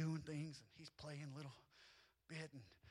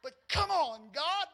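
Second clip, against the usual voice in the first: exasperated cry to God; 9 semitones higher, 12 dB louder, slower than usual. Retold or imitated. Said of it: "I'm sitting back in the office going".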